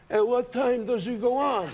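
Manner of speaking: mobster in the audience. Acted. Gangsters at ringside asking about the show, slow mobster voice. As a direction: draw it out like a ringside wiseguy. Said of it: "everybody came in and sat ringside going".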